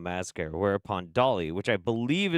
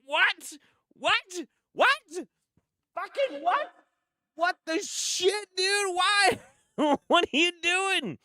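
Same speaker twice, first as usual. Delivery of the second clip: much higher, squeaking disbelief breaking into laughter; stunned disbelief. Real, not acted. Reacting to the hero tasing Dolly, real shocked squeals. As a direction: react like you can't believe it.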